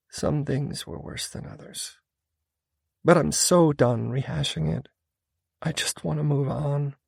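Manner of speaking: tired of rehashing it; weary woman. A narrator's reading. Female character, weary.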